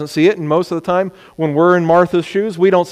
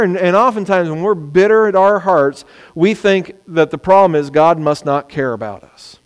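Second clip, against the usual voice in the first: ordinary talk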